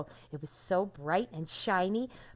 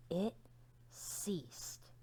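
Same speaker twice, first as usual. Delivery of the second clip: whispered word; 10 dB quieter than usual. Shown as a demonstration. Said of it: "What do you think the word ceased means".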